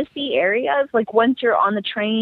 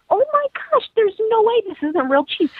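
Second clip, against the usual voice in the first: very high-pitched retold astonishment; amazed; 13 semitones higher than usual. Customer tasting vegan cheese, high amazed disbelief. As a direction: gush in disbelief, voice way up.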